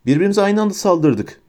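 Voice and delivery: high-pitched, mildly shouted objection; shouted protest. A narrator's reading. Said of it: "diye bağırdı Harry".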